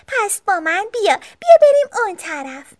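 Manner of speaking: put-on high little-girl bear voice; sister bear. A narrator's reading. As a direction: pipe up as a young sister bear.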